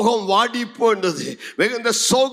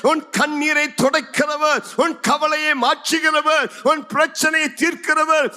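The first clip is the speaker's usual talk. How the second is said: high, ringing preacher's proclamation; impassioned preaching; 8 semitones higher than usual. Acted. Jesus calling the weeping by name, impassioned preaching. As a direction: call each one out, pitched high.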